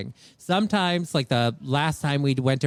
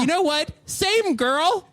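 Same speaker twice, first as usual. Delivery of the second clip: mock commiseration; 12 semitones higher, slower than usual. Mock solidarity with miserable parents.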